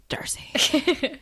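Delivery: breathless whisper; awed whisper. Retold or imitated. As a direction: whisper it in delighted awe. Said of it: "I was just like".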